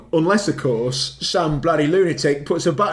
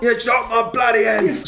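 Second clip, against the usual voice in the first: higher hammy Cockney cry; Cockney accent; 7 semitones higher than usual. Retold or imitated. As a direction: shout it in a comic Cockney accent. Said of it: "Cockney accent".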